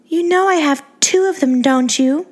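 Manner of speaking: put-on high, sweet baby-doll voice; Angeline's girly voice. A narrator's reading. To the narrator, a high, sweet starlet voice.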